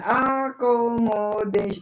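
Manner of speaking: Accommodation drawn out slowly, syllable by syllable, to count them. Shown as a demonstration. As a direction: spell out Accommodation slowly in beats. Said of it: "Five syllables".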